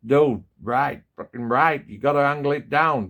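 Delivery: put-on a rough, half-hearted Canadian accent; tossing off a bad Canadian accent for fun; poor Canadian accent. Imitating Rob in Canada agreeing, in a poor Canadian accent. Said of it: "really poor Canadian accent".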